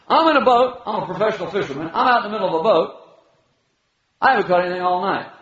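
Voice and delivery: fast, high, incredulous rant; a pro fisherman who can't believe it; laughing disbelief, rushed; exasperated fisherman. Retold or imitated.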